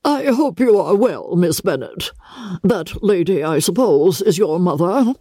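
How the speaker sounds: haughty great lady, clipped courtesy; very stiff. Acted. Lady Catherine to Elizabeth, stiff and cold. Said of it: "she said very stiffly".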